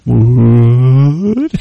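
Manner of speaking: long rising disbelieving what; drawn-out shocked question; stunned. Retold or imitated. Shocked reaction.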